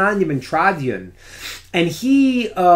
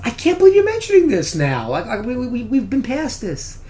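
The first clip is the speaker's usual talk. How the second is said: much higher, aggrieved protest; indignant disbelief; 8 semitones higher than usual. Retold or imitated. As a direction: protest that she raises it now. Husband hurt she brings it up.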